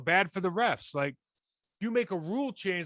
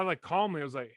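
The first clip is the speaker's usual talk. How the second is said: normal talking voice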